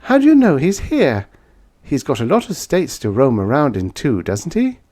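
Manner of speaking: lightly voiced woman pressing a question.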